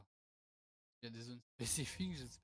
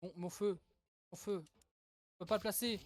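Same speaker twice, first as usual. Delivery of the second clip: normal talking voice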